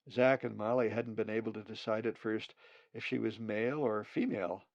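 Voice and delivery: ordinary delivery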